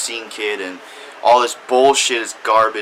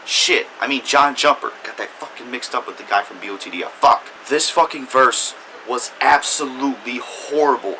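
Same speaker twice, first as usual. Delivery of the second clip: normal talking voice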